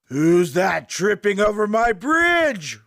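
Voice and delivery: loud, slow troll roar rising to the end. A narrator's reading. Troll challenges the little goat on his bridge, roaring. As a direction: bellow it out as a menacing troll. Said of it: "roared the troll".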